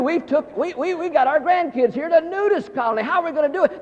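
high-pitched mock panic; flustered grandparents. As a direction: stammer in comic alarm. Grandparents panicking at nudist colony.

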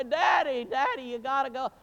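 high, loud pleading; children begging Dad. Retold or imitated. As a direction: beg Daddy to go out.

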